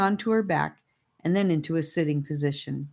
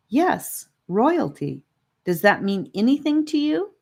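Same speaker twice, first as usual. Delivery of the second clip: put-on girl voice; 6 semitones higher than usual. A narrator's reading. Annika voiced, higher.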